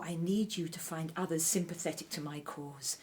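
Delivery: ordinary delivery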